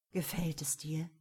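careful hushed question; gentle whisper. A narrator's reading. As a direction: ask it tenderly in a whisper. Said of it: "fragte er behutsam".

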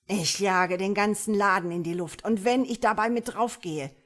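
loud, reckless outburst; frenzied threat. A narrator's reading. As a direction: shout it like a man gone mad. Crazed soldier threatens to blow everything up.

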